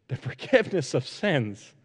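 slow, soft, wondering; savoring each word. Acted. Luther savors the words of hope, slow and soft. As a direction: taste every word, quietly amazed. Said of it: "as if he were savoring each word".

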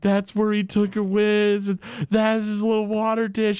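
high, mock tearful wail; Homer breaking down. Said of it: "Homer starts to break down".

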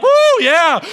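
high, loud whoop of joy; mock crowd cheer. The crowd cheering the son's return, a loud happy whoop.

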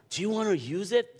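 imitating the eager owner, pitched well up; high woman's voice. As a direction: voice the lady high and keen. Woman voiced in retold story.

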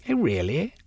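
mock his big idea; sarcastic. Sylvia mocking Velvein's suggestion, sarcastic. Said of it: "Velvein ignored her sarcasm".

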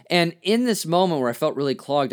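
ordinary talk

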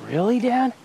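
a high, delighted little "Really, Dad?"; surprised son. Retold or imitated. Father retelling son's surprised delight on the phone, high and excited. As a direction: lift it up, a boy who can't believe his luck.

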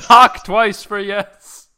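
slow loud mock séance call; jumpy whispering ghost hunt. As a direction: call out to the spirits, laughing. Séance call to missing friend.

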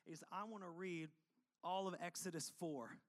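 ordinary talk

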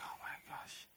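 hushed, voiceless murmur of amazement; awed whisper. Retold or imitated. Lying down after the dream, whispering in awe. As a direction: whisper it to yourself, overwhelmed.

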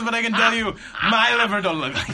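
high, loud imitated Russian accent; hammed-up Russian tech protesting, amused; Boris. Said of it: "as Borisy as possible".

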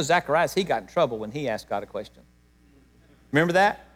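ordinary talk

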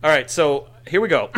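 normal talking voice

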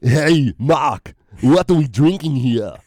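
a hint of Arnold, pushed out punchy; lightly voiced Austrian action-hero impression; Arnold Schwarzenegger. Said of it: "do your Arnold Schwarzenegger".

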